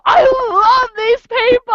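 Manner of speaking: high, slow ecstatic cry; mock overjoyed gush; hammed-up delight; gushing joy. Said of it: "I'm just like".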